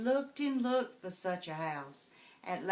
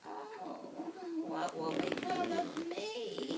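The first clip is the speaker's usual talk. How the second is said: muttering witch; 12 semitones higher than usual. Acted. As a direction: worry to yourself like a witch.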